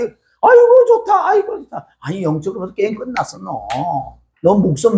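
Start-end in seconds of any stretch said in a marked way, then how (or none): 0.0-1.9 high-pitched gushing, imitated; mock delight. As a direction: mimic someone crowing with pleasure. Mimics people enjoying their comforts.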